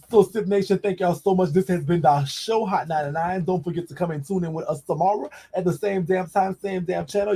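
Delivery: rally the listeners like a radio host; show host sign-off. Real, not acted. Host thanking Sip Nation at show's end, upbeat.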